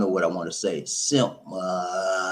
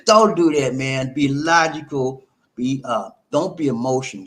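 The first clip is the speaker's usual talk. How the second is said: ordinary delivery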